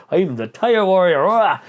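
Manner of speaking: mock warrior boast ending in a roar; mock boastful announcement. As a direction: announce yourself like a champion, in jest. Mocking a boast he avoided making.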